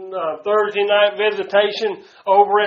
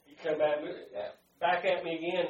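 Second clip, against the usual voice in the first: ordinary talk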